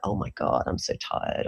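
whispered, worn-out moan; tired sigh. Retold or imitated. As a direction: sigh it out, drained after work. Exhausted mum retelling her evening.

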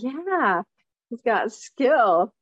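usual speaking voice

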